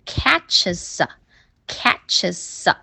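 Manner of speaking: "catches" slowly with the following a attached as sa; linking demo. Shown as a demonstration. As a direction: stretch it out as catches-sa. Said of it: "一连读就变成一个音". (慢速示范连读，catches 词尾连成 sa)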